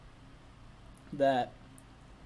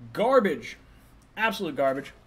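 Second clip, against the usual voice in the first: disgusted outburst; 4 semitones higher, 6 dB louder, slower than usual. Real, not acted. Disgusted at a reporter.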